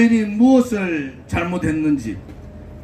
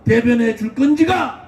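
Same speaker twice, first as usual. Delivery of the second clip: rally speech; 6 semitones higher than usual. Acted. Fiery rally speech to crowd.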